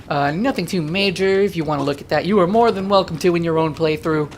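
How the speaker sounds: normal talking voice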